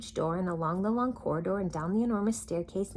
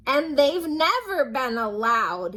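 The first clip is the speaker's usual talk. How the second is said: higher, loud, slow sob; sobbing; 7 semitones higher, 8 dB louder, slower than usual. Acted. King sobbing to Little Miss Sunshine. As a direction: sob through it. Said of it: "he sobbed".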